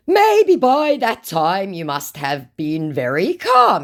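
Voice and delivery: slow, high, loud mock scammer; scammer's put-on voice. Acts scammer's reply.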